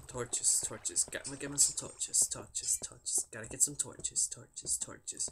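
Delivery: hushed, half-whispered repetition; soft, absent-minded mutter; muttering to self. Real, not acted. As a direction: chant it under your breath while searching. Muttering to self while searching.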